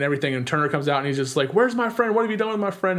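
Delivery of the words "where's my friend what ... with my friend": high-pitched mock yell at ordinary volume; mild, high retold scream. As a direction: imitate a frantic demand without really shouting.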